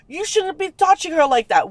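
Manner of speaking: high-pitched retold reprimand; indignant scolding. Friend telling the man off for rough touching, indignant. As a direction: tell him off, pitched well up.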